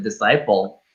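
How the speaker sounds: ordinary delivery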